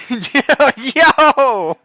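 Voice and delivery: high, loud burst of laughing yo; helpless cackling fit; real laughter. Real, not acted. Vlogger hearing the pizza call, bursting into loud laughter. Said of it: "I don't mean to laugh at this".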